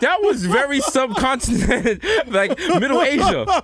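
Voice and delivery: high, laughing mockery. Real, not acted. Host teases the guest's accent while laughing. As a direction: tease him through laughter.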